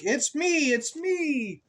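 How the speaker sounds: high, cheery little duck voice. Acted. Duck revealing itself to the child, high and cheery. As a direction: chirp it like a proud duck. Pig answers in story.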